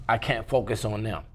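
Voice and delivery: normal talking voice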